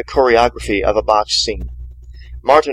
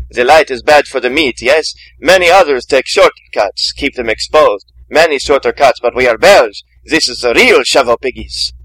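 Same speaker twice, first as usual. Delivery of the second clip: put-on louder, boastful Belgian accent; proud continental accent; 3 semitones higher, 6 dB louder than usual. A narrator's reading. Belgian butcher proudly showing the piglet machines, continental accent. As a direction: show off like a proud parent. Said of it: "like a proud parent showing off".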